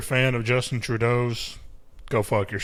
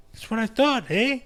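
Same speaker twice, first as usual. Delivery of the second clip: mock Canadian; 10 semitones higher than usual.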